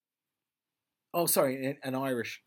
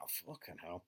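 muttered dismay; 6 semitones lower, 12 dB quieter than usual. Retold or imitated. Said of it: "You're just going".

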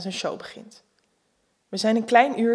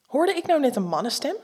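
mildly cross; 5 semitones higher than usual. Acted. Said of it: "zegt ze bozig".

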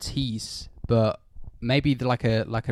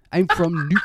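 higher-pitched hammed-up Geordie; Geordie accent; 6 semitones higher than usual. Shown as a demonstration. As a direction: eat every word in a Geordie accent. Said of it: "fucking eating every single word".

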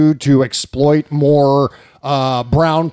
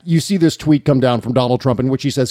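normal talking voice

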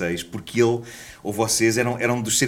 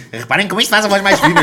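squeaky raised voice; mock high voice; 9 semitones higher, 7 dB louder than usual. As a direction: pitch it up like helium. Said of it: "ele estava assim".

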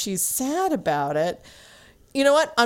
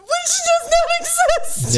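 high, slow and laughing, a bit louder; 4 dB louder, much slower than usual. Real, not acted. Laughing as she tells her cohost the city is fake. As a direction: laugh out the point.

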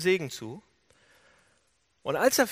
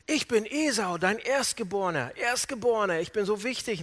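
mildly puffed-up claim, a little higher; self-important; 4 semitones higher than usual. Retold or imitated. Jacob posing as Esau to his father, self-important. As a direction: play up the firstborn's importance.

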